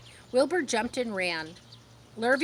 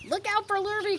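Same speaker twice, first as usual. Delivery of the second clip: high warning call; cows calling out; 11 semitones higher than usual. A narrator's reading. Cows warning Wilbur about Lurvy, high call. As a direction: warn across the yard. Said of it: "called the cows".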